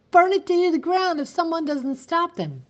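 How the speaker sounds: panting out the alarm; gasping for breath. A narrator's reading. Teacher reaching the sheriff, gasping for breath. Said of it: "gasping for breath".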